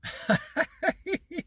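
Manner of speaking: high, quiet giggle; amused laugh. Real, not acted. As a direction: laugh at your own impression. Laughs at his own impression.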